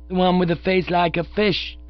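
put-on high, piping kid voice; freckled child protesting. A narrator's reading. Child pointing out an actor to pop, high protest. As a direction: complain as a small child. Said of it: "our little friend with the freckles".

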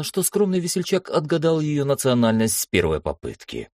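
ordinary delivery

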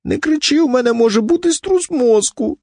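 very high, plaintive voice; protesting wife. A narrator's reading. Wife warns shouting husband of concussion, plaintive protest. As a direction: plead shrilly, pitched right up.

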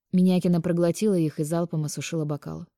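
usual speaking voice

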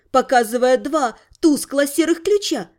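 plain reading voice

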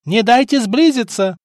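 high battle cry; yelled order. A narrator's reading. Stefan to crew as pirates close in, yelling. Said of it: "проорал Стефан".

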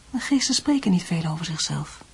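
hushed, secretive whisper. Acted. Mother confiding to her son about spirits, whispered. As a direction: breathe it like a secret about the dead.

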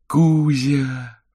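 put-on high, drawn-out child's call; joyful calling. Misha reaches out to the cat, high joyful call. Boy happily calls the cat.

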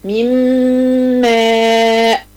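slow Quranic recitation of من ماء, the nun merged into the mim with a held ghunna; تلاوة بطيئة مع غنة ممدودة; nasal merging demo. Shown as a demonstration. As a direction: stretch من ماء very slowly, nun swallowed into a long humming mim. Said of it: "عايزة مثال مع الميم".

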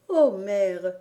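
loud, bright call to her mother; joyful cry. A narrator's reading. Little girl rushing to her mother, loud joyful cry. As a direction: burst out with the news. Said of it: "s'écria-t-elle".

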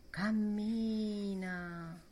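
trascina la parola lentissimo, allungando la emme; lento strascicato. A narrator's reading. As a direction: cammina detto piano come un passo pesante. Ripresa allungata del passo. (cammina, lentissimo e strascicato)

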